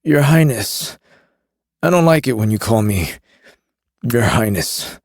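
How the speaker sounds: a queasy smuggler; Carrion voice. A narrator's reading.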